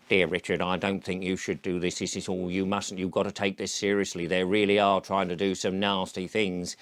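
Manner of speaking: a hint of a prim scold; mocking worried critic. Critics writing to Richard, low mock-earnest scolding. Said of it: "will go".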